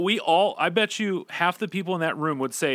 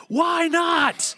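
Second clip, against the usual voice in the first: sudden full-throated scream, much higher and louder; 4 dB louder than usual. Retold or imitated. Youth pastor retelling a friend's scream in an icebreaker game, screaming at the top of his lungs. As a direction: explode into a yell out of nowhere. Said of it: "he just screams at the top of his lungs".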